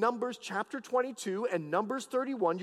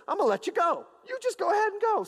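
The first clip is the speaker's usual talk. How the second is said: acted dismissive God; 11 semitones higher, faster than usual. God giving in to Balaam's repeated asking, acted high-pitched dismissal. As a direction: pitch climbing high on the send-off. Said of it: "God says to him".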